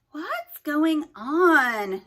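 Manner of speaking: very slow, high, loud startled question; startled cry. A narrator's reading. Haley startled by the opening wall, high and loud. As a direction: stretch out the alarm.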